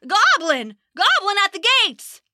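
high, panicked guard cry; alarmed shout. Acted. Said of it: "cried out in alarm".

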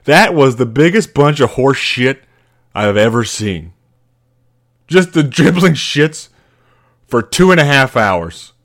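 rip into the team like an enraged coach; angry coach rant. Retold or imitated. Coach berating the team after the game, mock furious. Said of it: "And he goes".

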